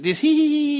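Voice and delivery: very high, loud "hee, hee, hee"; mock gleeful squeal. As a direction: imitate a kid gleefully running amok. Mimics boy trampling through flowers.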